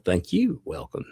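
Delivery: ordinary delivery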